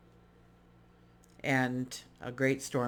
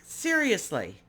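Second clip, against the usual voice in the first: incredulous; 8 semitones higher, 5 dB louder, slower than usual. Retold or imitated. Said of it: "she's kinda like".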